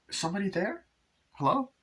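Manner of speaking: high, tentative mock call; wife calling out. Wife asking who is there, high imitated calling voice. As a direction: imitate a woman calling to an unseen stranger.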